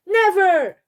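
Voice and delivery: high, loud refusal; defiant shout. A narrator's reading. Peter yelling at Dad that he'll never accept the baby, defiant shout. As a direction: yell it like a slammed door.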